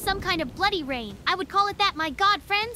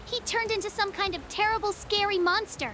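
ordinary delivery

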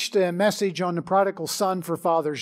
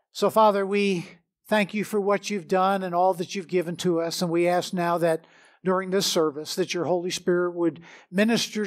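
prayer; 3 semitones lower than usual. Acted. Pastor leads opening prayer.

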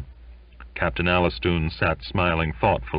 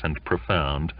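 ordinary delivery, nothing marked

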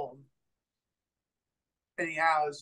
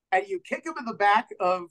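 ordinary talk, nothing marked

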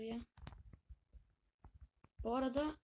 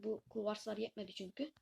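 normal talking voice

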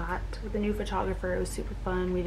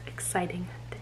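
whispering; 8 dB quieter than usual. Real, not acted. Whispered end of vlog intro.

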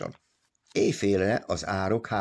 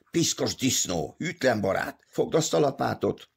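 higher-pitched angry abuse; roaring; 6 semitones higher than usual. A narrator's reading. Pion forcing Big Joe to dig, roaring. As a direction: build the insults up into an order. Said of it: "üvöltötte".

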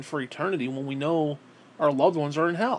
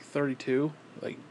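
usual speaking voice

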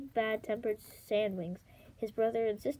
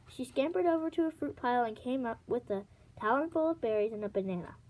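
plain reading voice

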